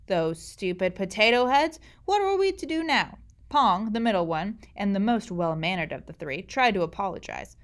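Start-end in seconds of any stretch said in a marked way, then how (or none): 0.1-3.2 exasperated. Acted. Uncle exasperated at boys.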